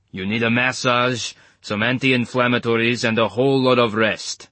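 put-on thick Eastern European accent, calm; medic's steady thick Eastern European accent. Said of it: "in his gloriously thick Eastern European accent".

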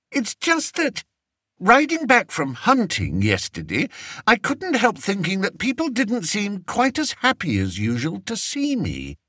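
high, fretful king voice; a spoiled king fishing for comfort; vain fretful king. A narrator's reading. Fred frets to his adviser.

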